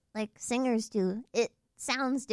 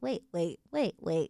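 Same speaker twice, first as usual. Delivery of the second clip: dropped low, back and forth; different timbre; 6 semitones lower, slower than usual. Shown as a demonstration. Friends goofing with voice timbres, dropped low. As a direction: flip down into a deep voice on each wait. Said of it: "tried a different ... timbre in my voice".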